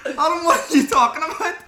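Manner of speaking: high, loud question through laughter; laughing disbelief. Real, not acted. Co-host baffled by a friend's plea for sponsors, laughing disbelief. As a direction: ask it incredulously while cracking up.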